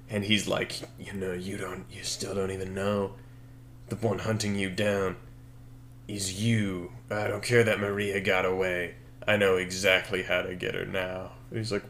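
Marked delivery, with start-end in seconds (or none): 1.0-11.3 lightly voiced villain, low and soft; quiet, understated menace for a villain; evil leader. Evil leader taunting Dan one-on-one, lightly voiced quiet villain. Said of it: "with the evil leader guy".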